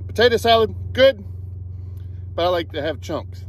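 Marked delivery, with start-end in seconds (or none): none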